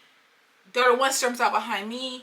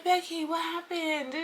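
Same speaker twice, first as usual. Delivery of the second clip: high, slow, drawn-out question; pitched-up, stretched sympathy; concerned friend; 6 semitones higher, 4 dB quieter, slower than usual. Retold or imitated. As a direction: coax her with mock concern. Acted concerned question to Becky.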